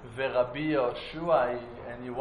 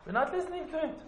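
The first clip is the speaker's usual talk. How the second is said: high-pitched dismissal; 13 semitones higher, 3 dB quieter, much faster than usual. Acted. Rabbis refusing the heavenly voice, high-pitched dismissal. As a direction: voice leaps up in mock refusal.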